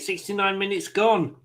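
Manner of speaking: ordinary delivery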